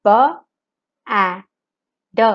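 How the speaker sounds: letter by letter, slow and spaced; sounding out words. Shown as a demonstration. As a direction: sound out B, A, D separately. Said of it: "sound out the letters and say the word".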